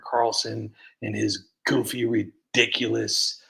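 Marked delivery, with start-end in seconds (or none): none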